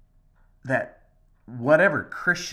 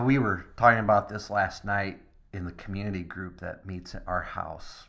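ordinary delivery

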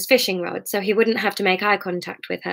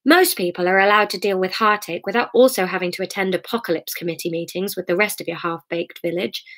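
ordinary delivery

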